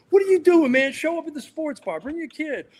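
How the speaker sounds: Barkley summoning him to the bar, excited; imitating Barkley's call. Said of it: "he goes".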